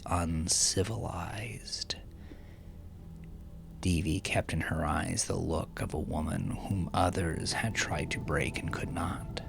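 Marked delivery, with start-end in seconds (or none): none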